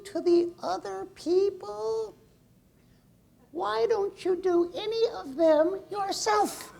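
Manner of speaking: very high, slow, drawn-out little voice; tiny inner voice. Acted. Little voice at the back of a coachee's mind, high tiny slow nagging. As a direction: squeak it slowly, like a small voice in the head. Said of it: "a little voice, a small voice, a faint voice".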